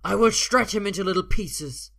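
loud, high, savage threat; furious full-voiced menace; screaming. A narrator's reading. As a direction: scream it to terrify them. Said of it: "Alvar screams".

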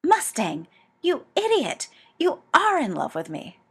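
loud, high furious accusation. A narrator's reading. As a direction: hurl it at him in fury.

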